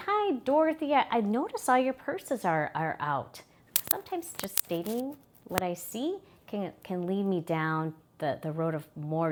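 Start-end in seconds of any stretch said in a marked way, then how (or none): 0.1-3.4 higher, louder hello to a care recipient; cheery, high-pitched opener, gently raising an observation; friendly greeting. Acted. Caregiver greets Dorothy about her purses, friendly and high.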